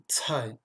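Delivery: "tai" as "tsaï" with a strong puff; hissed, aspirated ts starting "tai". Shown as a demonstration. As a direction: push air out after the ts of "tai". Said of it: "avec une forte expiration".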